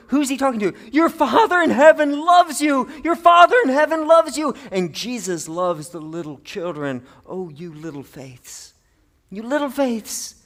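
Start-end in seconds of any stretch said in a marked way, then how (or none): 0.9-4.6 higher, louder, earnest promise; loud reassurance. Acted. Jesus voiced assuring disciples. 7.3-8.8 soft, slow, fond murmur; tender endearment. Acted. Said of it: "This is a term of endearment". 9.3-10.4 higher, slower, affectionate call; fond encouragement. Acted. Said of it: "This is a term of endearment".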